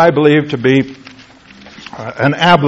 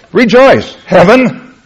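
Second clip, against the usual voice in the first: higher, louder ringing proclamation; exclaimed scripture quote; 6 semitones higher, 5 dB louder, slower than usual. Retold or imitated. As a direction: call it out to heaven.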